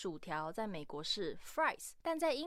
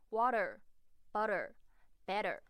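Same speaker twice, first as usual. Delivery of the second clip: American accent. Shown as a demonstration. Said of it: "美式口音则是会偏向的的滑音".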